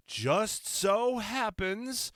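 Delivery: drawn-out, high, slow knowing tone; slow sly wink of a line; knowing sarcasm. Real, not acted. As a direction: let the irony hang on every word.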